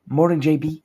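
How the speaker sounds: a hint of automated announcer; robot greeting. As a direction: chime it out like a voice assistant.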